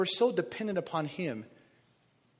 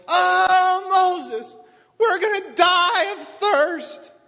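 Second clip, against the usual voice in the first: high, loud, slow whining wail; whiny screaming people; 12 semitones higher, 12 dB louder, much slower than usual. Retold or imitated. Israelites wailing at Moses, high mock whine. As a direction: wail like thirsty complainers. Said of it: "they're screaming at Moses".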